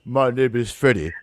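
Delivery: low, smooth character voice; sweat hog catchphrase. Retold or imitated. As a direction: drop into a deep sitcom introduction. Sitcom character's catchphrase imitated.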